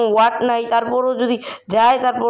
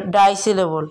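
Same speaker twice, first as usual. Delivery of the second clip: normal talking voice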